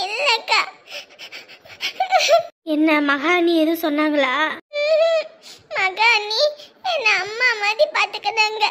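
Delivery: high, broken sobbing; girl telling her elder sister she feels like a burden, crying. Acted. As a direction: sob through every phrase. Girl cries about mother-in-law's talk.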